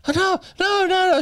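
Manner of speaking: high-pitched mock panic; imitated hysterical disbelief; retold panic. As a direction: cry out in horror, acting out someone's shock. Retelling her panicked reaction.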